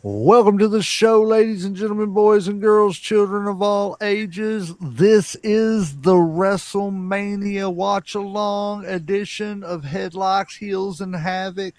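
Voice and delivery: booming, drawn-out ringmaster call. Acted. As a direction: welcome the crowd like a circus ringmaster. Circus-style show introduction.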